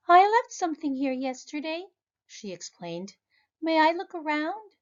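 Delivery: put-on a little girl asking politely; child voice.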